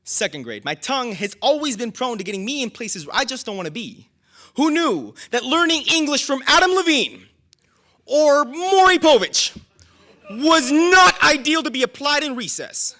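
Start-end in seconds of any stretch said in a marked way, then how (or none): 4.5-13.0 high, loud performed outburst; raised voice, punching each name; mock indignation. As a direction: belt it out to the crowd in disbelief. Poet's comic indignant outburst.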